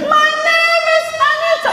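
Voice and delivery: squeaky high falsetto; mock a tiny soprano voice; high-pitched soprano voice of a lady named Anita. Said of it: "some high-pitched soprano voice".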